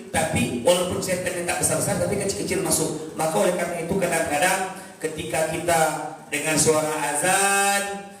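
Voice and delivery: ordinary talk, nothing marked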